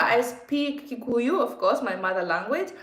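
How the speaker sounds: ordinary talk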